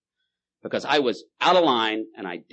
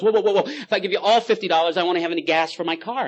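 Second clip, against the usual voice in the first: higher, louder panicked whoa; drunk backing out; 8 semitones higher, 5 dB louder than usual. Acted. Drunk backing out of the deal, higher and louder. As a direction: try to back out of the deal. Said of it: "trying to back out of the deal".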